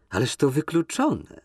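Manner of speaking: mock indignation. Nina pretends to be offended by Kolski leaving, mock indignant. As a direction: play offended, not really. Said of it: "z udawanym oburzeniem".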